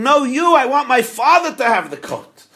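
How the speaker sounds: very high, loud protest; son screaming. Acted. Son screaming that father take the coat. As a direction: yell it right back. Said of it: "The son is screaming".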